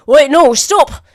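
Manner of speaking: slow loud yell to stop; shouting guard. Acted. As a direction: call out after the fleeing rat. Guard yells after fleeing rat.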